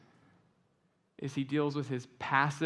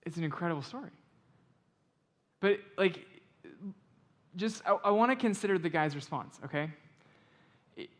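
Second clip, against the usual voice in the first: ordinary delivery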